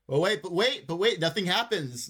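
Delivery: sudden high loud realization; surprised. Real, not acted. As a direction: catch yourself mid-thought, startled.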